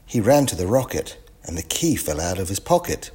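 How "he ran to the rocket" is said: ordinary delivery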